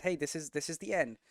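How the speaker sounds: normal talking voice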